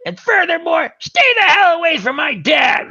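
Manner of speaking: high, furious shouted warning; hammed-up cartoon rage, high-pitched; Quagmire. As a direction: shout a livid ultimatum in a high voice. Quagmire warning Brian after beating.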